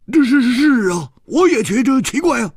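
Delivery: rough-voiced Hu Tiehua. Hu Tiehua suddenly agreeing with Chu Liuxiang. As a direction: blurt it out in surprise.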